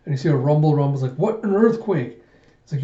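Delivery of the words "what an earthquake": high, drawn-out mock shock; acted-out alarm, slowed and higher; startled. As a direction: imitate a startled reaction, pitch jumping up. Retold shock as ground rumbles.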